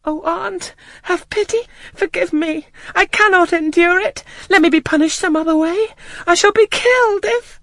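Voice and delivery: very high, desperate, begging cries; frantic pleading. A narrator's reading. Terrified child begging her aunt for pity, frantic. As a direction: beg for mercy as if your life depends on it. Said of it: "my now frantic anguish and wild sobs".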